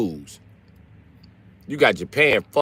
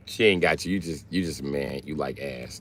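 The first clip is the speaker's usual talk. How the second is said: normal talking voice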